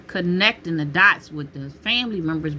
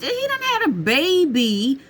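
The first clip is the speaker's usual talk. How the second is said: incredulous listing; 5 semitones higher than usual. Retold or imitated. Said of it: "I said".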